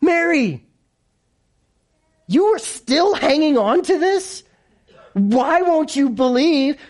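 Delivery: high, drawn-out disbelieving plea; mock exasperation. Preacher chiding Mary for not believing, mock exasperation.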